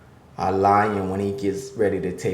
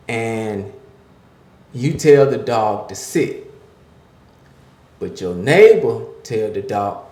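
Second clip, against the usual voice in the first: rising, louder preacher's cadence; preaching; 5 semitones higher, 8 dB louder than usual. Acted. Pastor preaching to the men listening, building and louder. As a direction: drive the point home like a sermon.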